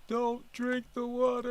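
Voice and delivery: very high, slow squeaky mock warning; mocking commenter. Host quoting a viewer comment in a mock high voice. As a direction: mimic the commenter in a silly falsetto. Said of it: "There was one guy that said".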